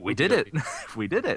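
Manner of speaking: excited. Real, not acted. Celebrating the funded Kickstarter.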